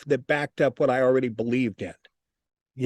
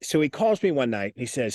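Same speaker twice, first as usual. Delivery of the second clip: ordinary delivery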